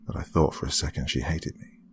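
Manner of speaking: ordinary delivery, nothing marked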